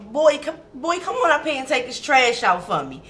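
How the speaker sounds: high, loud, friendly calling out; playful mom call. Retold or imitated. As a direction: call him up like a loving mother. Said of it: "So I can say certain things to him".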